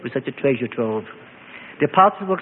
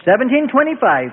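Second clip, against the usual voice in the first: pressing; 8 semitones higher, 3 dB louder than usual. A narrator's reading. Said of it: "Joseph pressed".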